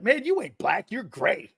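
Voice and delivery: high, incredulous teasing voice; mock-amazed high squeal of ribbing; Jerome Brown. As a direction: imitate a locker-room joker, pitch jumping high. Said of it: "Jerome's like".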